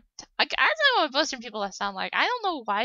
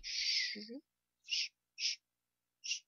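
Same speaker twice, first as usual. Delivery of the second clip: soft, repeated shushes; shushing; 11 dB quieter, slower than usual. Acted. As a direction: hush someone with short, soft shushes. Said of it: "could you, like, be like".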